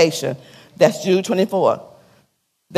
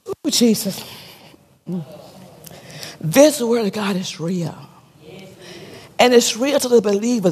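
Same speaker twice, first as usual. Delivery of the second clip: moved. Real, not acted. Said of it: "My heart is just hurt".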